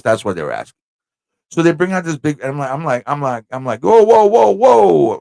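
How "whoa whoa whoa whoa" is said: high loud New York "Whoa, whoa, whoa"; a big startled New York "whoa". Retold or imitated. Startled by the platter, loud New York whoa. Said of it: "I get it all New York".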